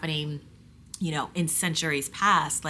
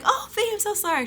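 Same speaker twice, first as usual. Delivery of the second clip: apologetic; faster than usual. Retold or imitated. Waking up, apologizing to mentor.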